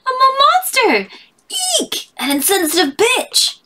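mock shriek. A cartoon girl shrieking at her own reflection, mock horror. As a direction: play the horrified cartoon girl.

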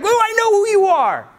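high, eager burst of recognition; excited Peter. Retold or imitated. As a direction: blurt it out like you finally get it. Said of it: "He was excited".

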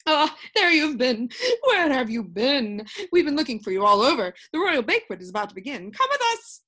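loud, hurried flurry of summons; agitated, out of breath. A narrator's reading. King's advisors rushing up to Milo, agitated and breathless. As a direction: rush it out, jumping up high at the end. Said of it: "very agitated and out of breath".

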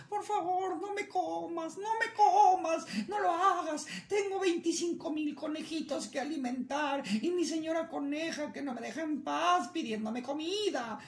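high, begging bunny voice; pleading rabbit. Acted. Rabbit begging the coyote, high pleading voice. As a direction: plead for your life in a squeaky voice.

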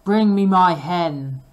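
loud, slow bellowing command; ogre shouting. Acted. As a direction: bellow for the hen. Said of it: "he shouted to his wife".

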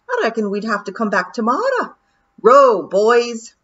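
a wee Scottish warrior urging the lads to row; Rob's Feegle voice. Acted. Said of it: "said Rob Anybody".

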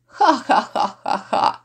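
staccato stage laugh, ha-ha-ha; mocking laughter. Lords jeering at the innkeeper's oath, mocking laugh. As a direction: laugh in separate mocking bursts.

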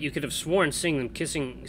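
ordinary talk, nothing marked

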